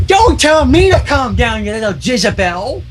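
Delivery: put-on high, slow cranky old lady; shrill old mare. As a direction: screech it like a bitter old crone. Old mare snaps at cashier.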